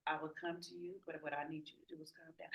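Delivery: ordinary delivery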